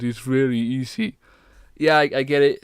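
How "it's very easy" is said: slow, put-on British accent; slip into British accent. Shown as a demonstration. Guest showing how easy slipping is, British accent. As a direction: let the voice slide slowly into a British accent. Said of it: "like a British accent".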